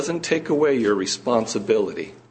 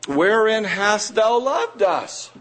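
slow, higher, questioning God; doubting people; 7 semitones higher, slower than usual. Retold or imitated. Preacher voicing Israel questioning God's love, slow and higher. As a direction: ask it slowly, a touch wounded. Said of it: "Yet ye say".

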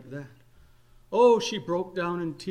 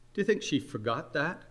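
ordinary delivery

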